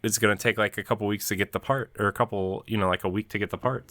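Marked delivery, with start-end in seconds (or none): none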